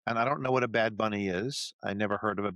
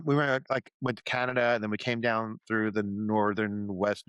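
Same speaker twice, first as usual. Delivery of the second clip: ordinary talk, nothing marked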